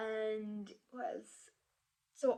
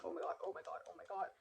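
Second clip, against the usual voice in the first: hushed, half-whispered fretting; retold panic; 2 semitones lower, 6 dB quieter, faster than usual. Applicant locked out of the interview app, quietly panicking. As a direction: mutter it repeatedly under your breath.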